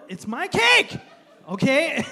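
high, loud possessive yell; mock-tantrum shout; defiant outburst. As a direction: burst out in comic defiance. Acted defiance over cake.

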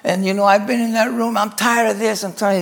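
retold angry outburst at the nurses; imitated mad rant. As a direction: act out a fed-up patient ranting. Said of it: "He's all mad and everything".

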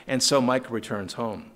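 usual speaking voice

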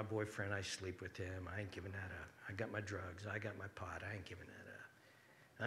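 low, muted digging-in of heels; stubborn refusal. Acted. Acting out a sinner refusing the invitation, low and stubborn. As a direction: dig in stubbornly, low and soft. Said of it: "and say, "No".

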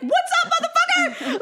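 high, laughing mock aggression; mock tough guy. Acting out being whapped by a pillow, high laughing mock threat. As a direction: squeal it like a jokey threat.

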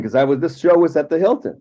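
ordinary talk

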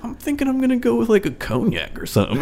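imitating a would-be sophisticated friend, pitch jumping high at the start; hammed-up fancy voice choosing a cognac; mock sophisticated. Imitating roommate Fred ordering after-dinner cognac, mock sophisticated. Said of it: "Fred would go sophisticated".

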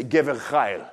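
Gever chayil with a guttural ch; Hebrew phrase. Shown as a demonstration. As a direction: say Gever chayil like a native speaker. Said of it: "they say".

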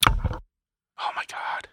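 slow, very soft whisper; whispered aside. Real, not acted. Co-host reacting to the failed accent, slow whispered aside. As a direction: whisper it slowly under the reading.

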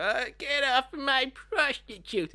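high, drawn-out mock protest; angry pimp, mocked. Comic reenacting a man pulling someone off his prostitute, mock indignant. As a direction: put on a hammed-up indignant pimp voice. Acts out pimp's rescue line.

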